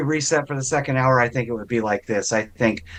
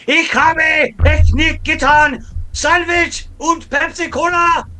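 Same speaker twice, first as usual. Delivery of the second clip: much higher, louder, slow hammed-up German; mock German accent; 3 dB louder, much slower than usual. Co-host parodying a German in a Trevor Noah skit, mock German accent. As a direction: bark out cartoon German slowly. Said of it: "straight out of a Trevor Noah skit".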